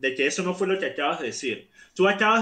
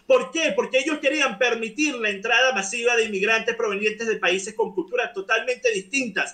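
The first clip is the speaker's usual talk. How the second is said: heated, higher-pitched rant; worked up; 4 semitones higher than usual. Real, not acted. Debater indignant at media double standards, worked up. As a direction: argue insistently, pitch raised throughout. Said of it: "No sé por qué te pones así".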